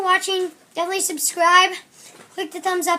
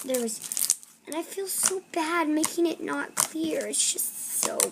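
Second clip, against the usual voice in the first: soft breathy ASMR whisper; 2 semitones lower, 7 dB quieter than usual. Acted. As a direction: whisper close to the mic. ASMR whispering over slime.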